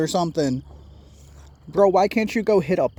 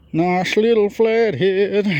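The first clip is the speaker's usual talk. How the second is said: slow, drawn-out and louder, savouring the catch; playful drawl; 3 semitones higher, 5 dB louder, much slower than usual. Real, not acted. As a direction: stretch every word out in a playful drawl. Playful repeat, admiring the catch.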